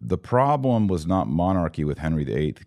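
ordinary talk, nothing marked

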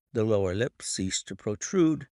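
usual speaking voice

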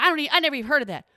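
fast rushed mock protest; hurried indignant cashier; cashier's rushed protest. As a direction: blurt it out quickly as the cashier. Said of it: "And she was like".

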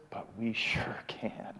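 slow whisper with breath only. Real, not acted. Sharing his turning point with the hosts, a slow whisper. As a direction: hush it out slowly like a secret revealed. Inflection point, intimate realization.

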